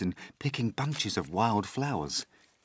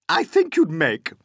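higher, louder snide aunt; Aunt Spiker sneering; 9 semitones higher, 9 dB louder than usual. Acted. Aunt Spiker mocking her sister, higher and louder. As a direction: set up the insult slyly. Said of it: "Aunt Spiker said".

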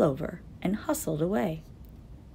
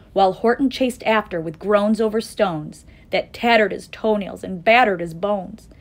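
ordinary delivery

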